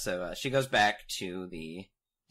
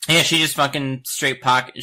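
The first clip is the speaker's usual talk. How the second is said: ordinary talk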